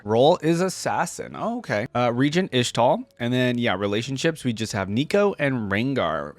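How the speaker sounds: normal talking voice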